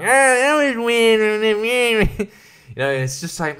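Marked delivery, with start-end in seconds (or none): 0.0-2.1 higher, very slow put-on critic voice; exaggerated YouTube critic drawl; mock film reviewer. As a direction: stretch it out like a nitpicking reviewer. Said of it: "being like".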